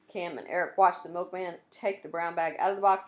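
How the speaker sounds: usual speaking voice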